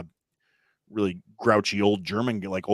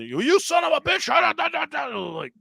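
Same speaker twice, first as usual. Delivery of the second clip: very high, loud mock tirade; angry chef yelling; 4 dB louder than usual. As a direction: explode into a ranting chef, far above your usual pitch. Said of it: "angry, yell, rah, rah, rah".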